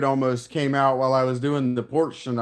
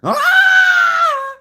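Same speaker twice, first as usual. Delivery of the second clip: very high, loud rocker wail; glam rock scream; 10 dB louder than usual. Retold or imitated. Streamer imitating the singer's high scream. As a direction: shriek like a hair-metal singer. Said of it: "too much of that, uh".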